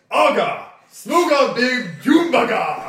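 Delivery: mock giant speech. Player mimics giant language, laughing.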